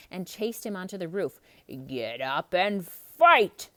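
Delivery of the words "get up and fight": slow, loud taunt. A narrator's reading. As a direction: goad him to fight. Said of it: "Gaston taunted".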